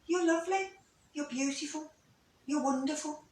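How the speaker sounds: soft, high and gentle; hushed, lilting praise in a lifted voice; lovely inner voice. Acted. Teacher voicing the kind voice heard when quiet, soft and high. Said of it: "there's always this lovely voice that goes".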